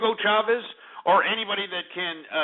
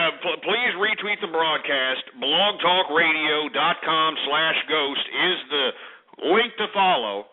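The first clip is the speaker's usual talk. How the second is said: ordinary delivery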